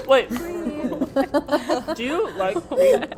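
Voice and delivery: high, loud laughing question. Real, not acted. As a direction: ask it while giggling. Laughing question then plain repeat.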